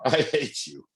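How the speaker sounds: high and light, "I hate you" as a tease; a joking, affectionate "I hate you"; playful I hate you. Shown as a demonstration. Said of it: "How about this?".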